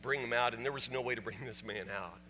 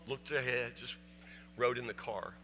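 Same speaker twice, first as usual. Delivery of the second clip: ordinary talk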